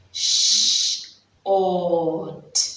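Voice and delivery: "sh-" then "ot" split apart; segmenting sounds. Shown as a demonstration. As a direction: sound out "sh-" and "ot" separately. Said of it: "let me say".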